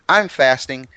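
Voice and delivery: ordinary delivery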